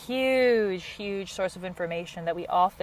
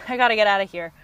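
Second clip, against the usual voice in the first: louder and faster, with a laugh; laughing escape; 2 semitones higher, 8 dB louder, faster than usual. Retold or imitated. Overwhelmed partner wants to escape the argument, laughing and louder. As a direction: blurt it out through a laugh like you're bolting.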